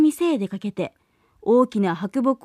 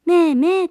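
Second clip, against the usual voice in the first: a higher, reassuring goat bleat; mother goat's bleat; 5 semitones higher, 5 dB louder than usual. Acted. Mother goat bleats goodbye to her kids, calm goat bleat. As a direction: bleat it like a contented nanny goat. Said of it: "と言って安心して".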